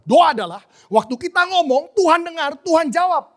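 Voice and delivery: high, loud recited definition; emphatic teaching. Retold or imitated. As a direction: drive every phrase home to the class. Lecturer proclaims the full definition. (definisi diserukan lantang dan tegas)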